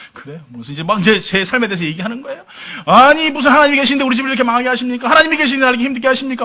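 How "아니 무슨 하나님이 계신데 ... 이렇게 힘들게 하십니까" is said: high, loud, rapid-fire angry challenge; raised-voice retold outburst, pressing fast; yelling in protest. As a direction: re-enact shouting accusations at the pastor. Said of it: "소리를 고래고래 지르면서 그냥 막 따졌어요".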